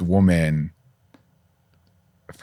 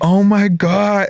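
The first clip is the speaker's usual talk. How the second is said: slow, much higher, stunned gasp of disbelief; shocked oh my god; 12 semitones higher, 4 dB louder, much slower than usual. Retold or imitated. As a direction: stretch it out high and louder, scandalized. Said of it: "And I'm like".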